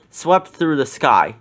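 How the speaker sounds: ordinary delivery, nothing marked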